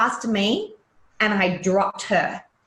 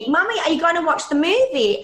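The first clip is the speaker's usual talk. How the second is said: put-on kids asking; 8 semitones higher than usual. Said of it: "they'd be sitting there going to me".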